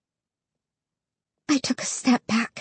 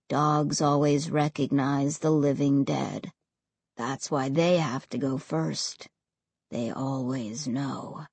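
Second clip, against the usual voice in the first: cold and low, fading away; deep and cold; 10 semitones lower, 7 dB quieter than usual. A narrator's reading. Ray's voice continues.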